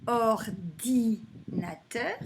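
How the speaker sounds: Ordinateur slowly separated as or, di, na-teur; slow, measured or-di-nateur; repeated by syllable. Shown as a demonstration. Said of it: "alors je répète".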